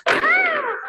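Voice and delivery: sudden high shriek of surprise; startled shriek. A narrator's reading. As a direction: shriek as the chair cracks. Chair breaks under Goldilocks.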